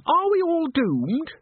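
very high, drawn-out squeal; high, slow wail of dread; panicked squeak. A narrator's reading. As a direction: squeak it like a terrified ingenue. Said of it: "squeaked Christine".

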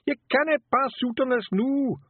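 high, loud Scots scream. Acted. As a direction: scream her awake. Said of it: "Rob screamed".

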